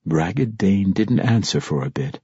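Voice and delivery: ordinary delivery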